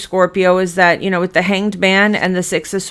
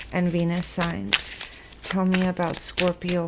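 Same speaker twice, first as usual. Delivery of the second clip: soft invocation; 6 dB quieter than usual. Acted. Tarot reader softly asks spirit about Scorpio. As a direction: murmur a plea to spirit while shuffling.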